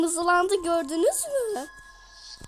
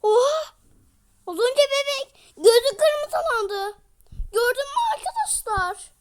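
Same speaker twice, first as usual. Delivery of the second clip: startled; 8 semitones higher, 5 dB louder than usual. Real, not acted. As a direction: gasp it out in disbelief. Scared by doll's red eyes.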